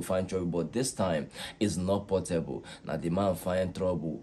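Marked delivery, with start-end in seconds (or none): none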